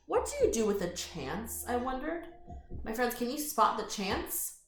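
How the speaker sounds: plain reading voice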